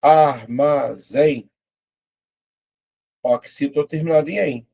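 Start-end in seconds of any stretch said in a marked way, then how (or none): 0.0-1.5 ARMAZÉM very slow, ar-ma-zém; ARMAZÉM in three drawn-out beats; syllable by syllable. Shown as a demonstration. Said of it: "vou separar".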